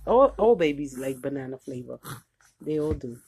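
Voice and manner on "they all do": usual speaking voice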